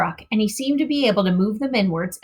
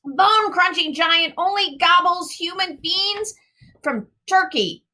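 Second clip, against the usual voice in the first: friendly giant; 10 semitones higher than usual. A narrator's reading. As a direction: boast like a big clumsy giant. Giant voice continues.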